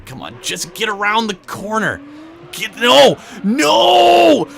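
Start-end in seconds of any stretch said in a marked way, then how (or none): 2.5-4.5 loud, high yells of alarm then triumph; excited shout. Real, not acted. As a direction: yell as the car nearly fails then makes it. Finishing the license test.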